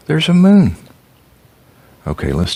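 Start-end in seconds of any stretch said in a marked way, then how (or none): 0.1-0.8 excited reveal. Acted. Reading picture book to child.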